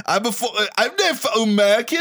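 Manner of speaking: put-on high, botched British accent; garbled fake accent. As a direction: stumble through an unplaceable British accent. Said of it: "I don't know what that accent was".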